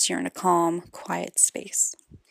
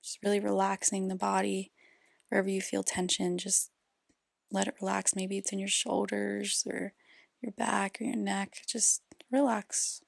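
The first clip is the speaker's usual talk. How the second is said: guided meditation; 6 dB quieter than usual. Acted. Host leads parents through inner child healing, guided meditation. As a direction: guide them gently inward, softly, leaving space between phrases.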